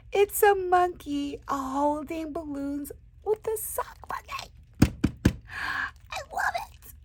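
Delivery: high breathy whisper, nearly crying with joy; overcome whisper. Real, not acted. Crafter overwhelmed by a sock monkey, teary whisper. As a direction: whisper like it's too much.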